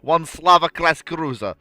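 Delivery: hammed-up Soviet admiral briefing his commander; Soviet accent. Soviet commander briefing a comrade, in a booming Russian accent. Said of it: "Best Soviet accent".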